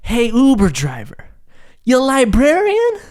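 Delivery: imitating yelling at the driver; mock confrontation. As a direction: demand it slowly, outraged.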